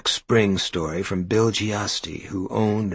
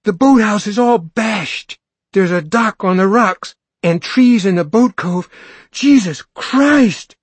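put-on high, loud, breathless kid voice; panting excited boy; 12 semitones higher, 9 dB louder than usual. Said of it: "Billy panted".

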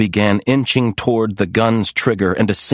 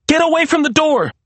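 high, urgent cry; screamed warning; 3 dB louder than usual. A narrator's reading. Maria pulling Serena from the door, screamed warning. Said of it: "Maria screamed".

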